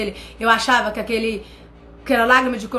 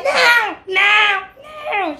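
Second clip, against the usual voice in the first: put-on crying child; 9 semitones higher, much slower than usual. Filho chora e recusa.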